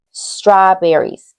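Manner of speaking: strawberries said slowly, every syllable clear; teacher models a new word for the children. Shown as a demonstration. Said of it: "Can you say strawberries?".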